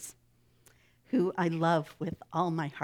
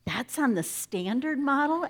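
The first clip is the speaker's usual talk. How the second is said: high-pitched, incredulous query; taken aback, voice jumping up; astonished question; 8 semitones higher, 4 dB louder than usual. A narrator's reading. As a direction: ask it in amazement, pitch lifted. Angel astonished by the design.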